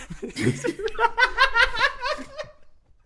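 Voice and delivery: high helpless laughing fit; cracking up for real; real laughter. Real, not acted. GM loses it at his own line, laughing hard. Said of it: "This bit broke me".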